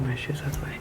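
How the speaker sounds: hushed whisper so no one wakes; library whisper. Real, not acted. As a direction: whisper softly, people asleep upstairs. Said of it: "I'm whispering because people are upstairs asleep".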